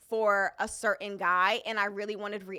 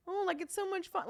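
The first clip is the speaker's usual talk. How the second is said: high, sweet innocent fib; 9 semitones higher, 6 dB quieter than usual. Retold or imitated. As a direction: play it innocent and bright. Retold own reply to uncle.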